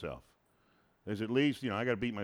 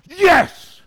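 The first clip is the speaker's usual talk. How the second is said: loud, high burst of celebration; triumphant cheer; 20 dB louder than usual. Acted. God rooting for you from the throne, exultant shout. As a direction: cheer like a fan whose player just scored. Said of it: "and he goes".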